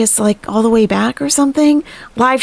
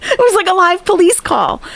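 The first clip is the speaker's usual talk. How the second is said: high-pitched, laughing words; talking through laughter; 11 semitones higher than usual. Real, not acted. Storyteller amused by the live police call, laughing. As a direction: squeeze the words out while laughing.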